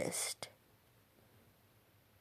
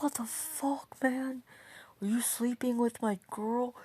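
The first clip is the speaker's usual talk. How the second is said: high-pitched, fairly soft outrage; squeaky-high retold indignation; mock pissed-off; 6 semitones higher, 4 dB quieter than usual. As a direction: imitate an angry, betrayed boyfriend without shouting. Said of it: "Bel's pissed".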